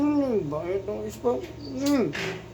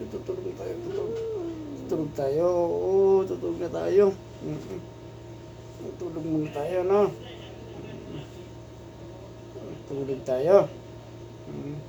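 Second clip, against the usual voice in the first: soft lulling; 4 semitones lower, 5 dB quieter than usual. Real, not acted. Lulling the dog to sleep.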